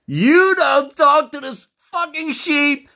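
high, loud, drawn-out shriek; frantic screamed denial. Acted. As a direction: scream it in hammed-up panic, stretching the words. Taliban's frantic punchline.

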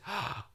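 a sharp, quiet gasp of dismay; shocked gasp. Retold or imitated. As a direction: suck in breath like a father in shock. Jacob's shocked reaction, acted.

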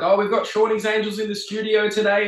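chirpy presenter plug; radio host voice. Retold or imitated.

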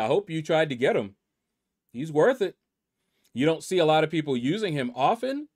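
usual speaking voice

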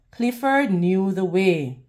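ordinary delivery, nothing marked

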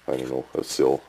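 ordinary talk, nothing marked

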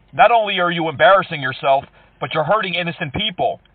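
usual speaking voice

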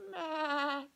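high, loud bleat; lamb bleat. Acted. Lamb bleating from the hay shed, high loud bleat. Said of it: "came a sound".

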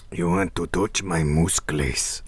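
soft, low, slow macho voice; lightly voiced muscleman; mock macho voice. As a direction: tease like a flirting muscleman. Reading art caption in voice.

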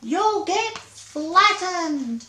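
high, very slow wail. Acted. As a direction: drag out the warning in despair.

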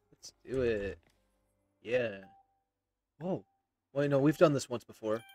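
ordinary talk, nothing marked

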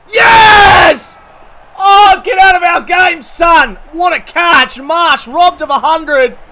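screaming with joy at a catch; ecstatic shouting. Real, not acted. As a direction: roar it like a match-winning catch. Celebrates a great catch.

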